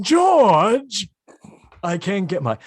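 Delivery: loud high calling voice; Isabel Sanford. Retold or imitated. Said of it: "nailing your Isabel Sanford".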